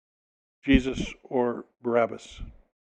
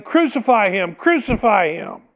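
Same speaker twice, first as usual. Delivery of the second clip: crowd yelling, higher and louder; louder chant of the mob; mob shouting; 6 semitones higher, 4 dB louder than usual. Acted. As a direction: call it out like an angry mob. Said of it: "they kept shouting".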